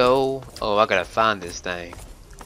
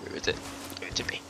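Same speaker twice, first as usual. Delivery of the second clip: whispering; 14 dB quieter, much faster than usual. Real, not acted. Whispered search.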